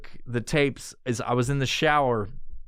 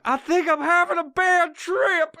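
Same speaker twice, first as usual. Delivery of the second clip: much higher, louder, panicky voice; panicked high whine; 14 semitones higher, 4 dB louder than usual. Retold or imitated. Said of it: "and I'm like".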